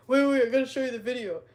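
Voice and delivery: put-on quoting herself eagerly urging the boyfriend to watch; excited rush. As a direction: hurry to show the video. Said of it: "And I was like".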